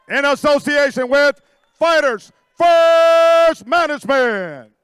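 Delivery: boxing ring announcer. Acted. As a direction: roll the names out like a ring announcer.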